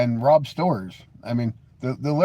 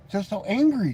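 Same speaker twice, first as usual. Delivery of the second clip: mock pity; 6 semitones higher than usual. Mocking the angry commenter.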